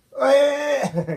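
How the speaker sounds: big burst of high, loud laughter. Real, not acted. Dad laughing loudly while hugging his daughter.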